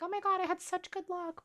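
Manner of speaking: high-pitched imitated gushing; hammed-up boast about luck; mock bragging. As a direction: put on a high voice to brag. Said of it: "gonna try and brag and be like".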